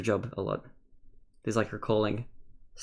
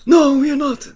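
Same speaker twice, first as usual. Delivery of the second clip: loud character voice; 14 semitones higher, 10 dB louder than usual. Acted. Artist answers loudly in character.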